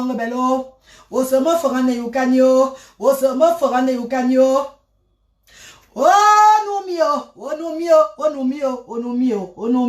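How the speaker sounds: grief pouring out in a sung wail; wailing lament. Real, not acted. Wailing lament on hearing of death. (lamentation en pleurs, cris de deuil chantés)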